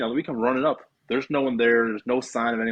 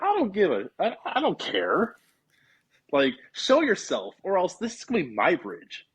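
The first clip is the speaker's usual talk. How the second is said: pitched-up, cocky taunt thrown into the dark; defiant dare; 6 semitones higher than usual. Retold or imitated. Retelling how he taunted the ghost at the bridge, a higher cocky dare. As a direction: dare the spirit to show itself. Daring the angry spirit at bridge.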